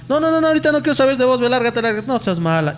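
high-pitched mock refusal; hermana enojada imitada. Hermana enojada rechaza en la pelea. (rechazo imitado de hermana, voz aguda)

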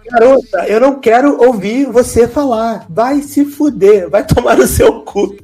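high, slowing, emphatic mock tirade; mimicked telling-off. Re-enacting Lid telling her rival off, high mock anger. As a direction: act out a fed-up, high-pitched dismissal. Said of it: "a Lid virou pra você assim".

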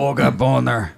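mock ogre mangling, Olga boner; silly pronunciation. Teasing co-host with a goofy Olga boner. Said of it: "it's so fun to say".

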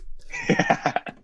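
high burst of laughter; loud real laugh. Real, not acted. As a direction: laugh out loud at own joke. Laughs at own joke.

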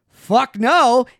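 loud, high, emphatic refusal, retold. Teenager refusing to take his daughter to prom, loud refusal. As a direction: blurt the refusal at full volume.

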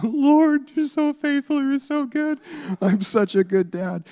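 high, loud, gushing praise; mock proud prayer. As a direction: gush to God like the proudest dad.

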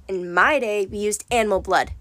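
plain reading voice

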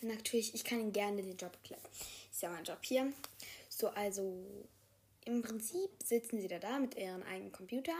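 usual speaking voice

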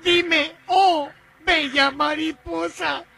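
grand, slow poem recital; poetry declamation. Acted. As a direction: declaim it like a mock romantic poet. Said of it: "Mira, ahí te va".

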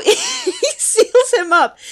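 loud, high, drawn-out words through real laughter; laughing disbelief. Real, not acted.

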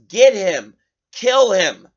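loud quoted crowd yells; short, forceful mock yells; retold screaming. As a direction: imitate an angry mob's shouts, each punched out. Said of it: "people were screaming".

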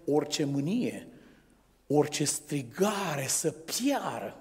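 slow, solemn reading of scripture; solemn Bible quotation, read slowly; slow scripture quote. Acted. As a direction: let each word of the verse land slowly. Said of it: "Biblia spune".

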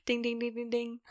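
high, even ding-ding-ding; imitated bell. Co-host rewarding the right answer, high pretend bell.

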